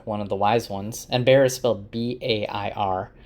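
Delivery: ordinary talk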